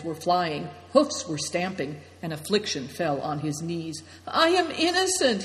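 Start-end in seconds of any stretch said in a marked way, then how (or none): 4.3-5.4 high, pleading wail. A narrator's reading. Affliction pleads on his knees, wailing. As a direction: wail it high, protesting innocence. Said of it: "he wailed".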